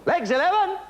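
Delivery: high, loud incredulous repeat; surprised echo. Real, not acted. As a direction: echo it back in mock astonishment. Host echoes bingo call.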